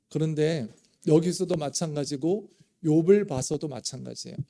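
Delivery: normal talking voice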